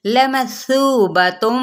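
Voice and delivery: लमसूबतुम slowly with a full heavy qaf; tajweed demo. Shown as a demonstration. Teacher showing students the heavy qaf, slow and careful. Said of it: "पुर है".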